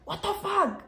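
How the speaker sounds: high, stunned reaction, acted out; surprised cousin. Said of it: "she was also surprised".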